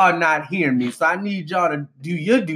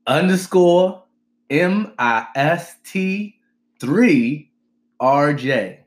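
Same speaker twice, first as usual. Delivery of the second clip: slow, letter by letter; over enunciating; much slower than usual. Shown as a demonstration. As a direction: space out every letter. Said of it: "spell 'em out and enunciate".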